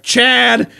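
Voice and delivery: high, loud retold exclamation; mock scolding cry. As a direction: call the name in imitated dismay. Cousin exclaims at the accident.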